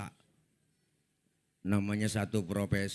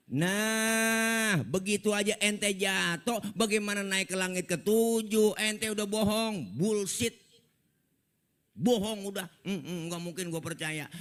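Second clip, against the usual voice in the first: scornful taunt; 8 semitones higher than usual. Acted. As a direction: jeer at him for lying. Abu Jahal menuduh bohong. (ejekan keras dan tinggi)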